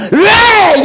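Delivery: sudden high, loud shout of why; heated shout. Shown as a demonstration. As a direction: burst out as if the temper slipped. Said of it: "목소리 낮춰서 안 그러면 막".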